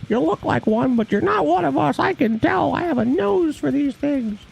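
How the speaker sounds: put-on high smug old lady voice. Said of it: "I'm an old lady".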